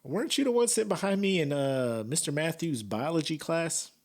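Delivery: high, taunting imitation of the judge; mock judge. Judge teasing a former classmate in the dock, mock taunting. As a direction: needle an old classmate with high, gloating huhs. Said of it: "she's just like".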